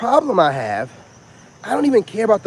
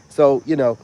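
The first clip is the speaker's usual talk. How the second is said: ordinary talk, nothing marked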